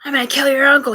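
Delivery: loud, heated mock threat rising at the repeat; retold threat. Voicing a stranger's threat heard through her mouth, loud and heated. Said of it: "he said".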